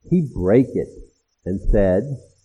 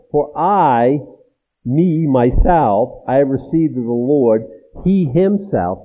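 slow, stressed, louder; emphatic; 5 dB louder, slower than usual. Shown as a demonstration. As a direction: lean hard on each word of I myself. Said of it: "The words are emphatic".

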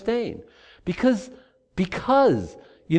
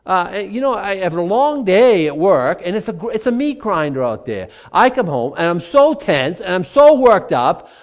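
louder, slowed, exasperated venting; worked-up complaint; 2 semitones higher, 7 dB louder than usual. Pretend. Pastor acting a tense man home from work, loud and exasperated. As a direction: pile on the stress of the day, pushing harder.

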